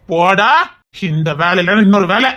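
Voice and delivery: normal talking voice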